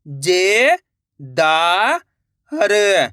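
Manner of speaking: ordinary talk, nothing marked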